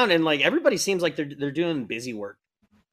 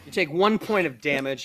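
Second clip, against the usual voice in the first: ordinary delivery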